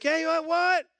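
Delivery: high loud irritated what; frustrated what. Retold or imitated. Pastor pretending not to hear his friend, irritated shout. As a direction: snap back over the noise of the shower. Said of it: "And I'm like".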